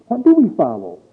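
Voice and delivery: normal talking voice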